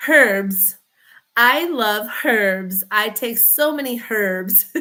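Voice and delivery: slow, drawn out erbs; herbs with a silent h. Shown as a demonstration. Mocking American erbs.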